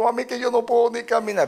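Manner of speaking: high-pitched plea to mami; whining child. Retold or imitated. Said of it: "Y yo, yo llorando".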